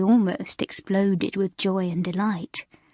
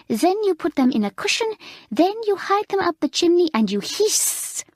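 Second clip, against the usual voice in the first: put-on higher, louder French-accented tirade; raging French mistress; 6 semitones higher, 3 dB louder than usual. A narrator's reading. Mamselle scolding Alicia and the sixth form, raging. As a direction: storm at them line after line. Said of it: "She raged and stormed".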